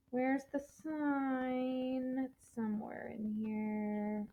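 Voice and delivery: slow, soft, drawn-out words; absent-minded searching. Real, not acted. Teacher hunting for the page, slow and absent-minded. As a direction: talk to yourself while hunting for something. Searching the book while talking.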